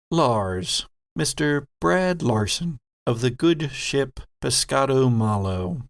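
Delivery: ordinary talk, nothing marked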